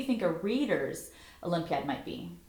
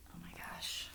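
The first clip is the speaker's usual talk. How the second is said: whispered gasp; 16 dB quieter than usual. Real, not acted. Reacting to story.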